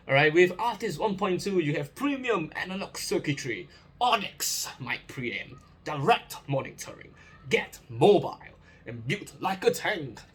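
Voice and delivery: ad-read American accent, upbeat. Real, not acted. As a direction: slip into a US product-ad voice. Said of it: "my American accent just came out".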